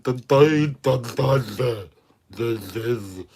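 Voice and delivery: mock a rambling host stuck on one word; stuttering parody. Streamer mocks a rival host with a loud stuttered the. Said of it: "Those five seconds of fucking content".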